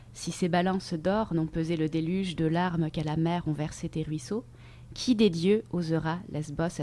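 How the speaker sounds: soft, measured poem recitation; poetry reading. Acted. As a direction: read the verses softly, letting each phrase settle. Reads a poem aloud. (lecture de poème, posée et douce)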